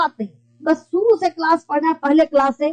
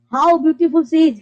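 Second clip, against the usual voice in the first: slow model exclamation; much slower than usual. Shown as a demonstration. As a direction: say it slowly in wonder. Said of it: "कितना सुंदर है वो". (धीमा आश्चर्य वाक्य)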